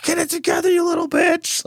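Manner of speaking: Gordon Ramsay yelling. Retold or imitated. Imitating Ramsay dubbed over the kids' cooking show, screaming. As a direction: scream it like a furious TV chef at a crying kid. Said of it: "and he's like".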